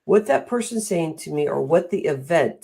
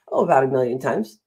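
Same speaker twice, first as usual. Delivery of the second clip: ordinary delivery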